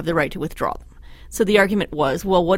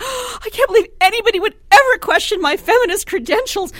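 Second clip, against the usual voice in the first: high, loud whimpering outrage; mock offended politician; 13 semitones higher, 7 dB louder than usual. Columnist imitating Greg Clark in the House, melodramatic whine. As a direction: overact hurt pride to the rafters.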